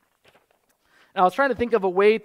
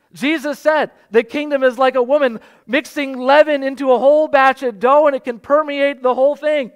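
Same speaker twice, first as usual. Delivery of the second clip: louder, higher, jubilant preaching; full-force preaching; 5 semitones higher, 8 dB louder than usual. Acted. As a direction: lift the room with the good news. Preaching climax on good news.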